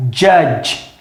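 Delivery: judge said slowly with no final vowel; slow model word. Shown as a demonstration. Teacher showing students that judge has no final E. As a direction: stretch judge out and end on the j sound. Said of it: "a gente não fala o E".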